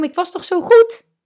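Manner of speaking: fired employee protesting to himself; indignant disbelief. Retold or imitated. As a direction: burst out in hurt disbelief. Fired worker's disbelieving outburst.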